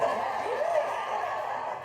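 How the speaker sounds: airy giggle, almost voiceless; real laugh at the memory; high breathy laugh. Real, not acted.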